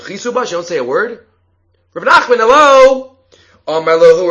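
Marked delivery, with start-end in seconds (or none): 1.9-3.2 loud, much higher call for attention. Acted. Woman calls out to Rav Nachman, loud and indignant. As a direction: demand he finally listen.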